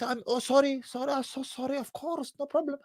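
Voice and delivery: high, soft, timid apology; a mock shy cashier; shy apologetic cashier. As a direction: shrink and apologise profusely. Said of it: "She had the shyest".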